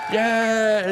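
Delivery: high, loud cheering yell; imitated crowd cheer. Thousands of people cheer him up the drain with a loud yeah. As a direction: whoop like a stadium crowd.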